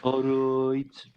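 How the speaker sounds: exaggerated Birmingham vowels; put-on Birmingham accent; Brummie accent. Shown as a demonstration. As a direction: stretch it out in mock Brummie. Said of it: "it's that kind of".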